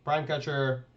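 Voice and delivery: ordinary delivery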